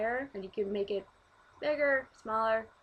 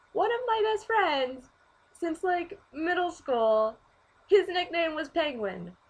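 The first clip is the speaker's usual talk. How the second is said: high, louder, thrilled delivery; excited; 6 semitones higher, 4 dB louder, slower than usual. Real, not acted. Telling viewers about a friend's nickname, excited. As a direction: gush over the coincidence.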